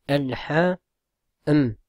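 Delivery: "al-ha-m" split slowly into parts; syllable by syllable. Shown as a demonstration. Teacher spelling out the word for students. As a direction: break "al-ha-m" into beats. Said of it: "il est prononcé".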